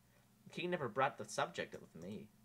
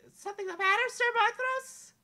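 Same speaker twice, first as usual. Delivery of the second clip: princess Amelia voice; 14 dB louder than usual. Acted. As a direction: sweetly ask the knight.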